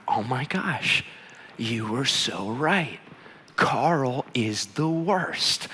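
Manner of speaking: soft, heavily drawn-out verdict; hushed exasperation. Retold or imitated. Husband to wife alone in the car, hushed exasperation. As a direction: confide it slowly, weighing each word.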